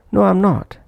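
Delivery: "I'm" reduced between a high "No" and "not"; weak-form "am". Shown as a demonstration. Teacher demonstrating the weak form in an answer, "I'm" not prominent. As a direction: keep the "am" in "I'm" short and unstressed. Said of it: "that's why not pronounced prominently".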